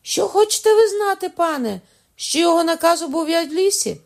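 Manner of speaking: very high, loud words through mock agony; hammed-up pained cry. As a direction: cry the words out in overplayed pain. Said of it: "ніби аж задушився від крику".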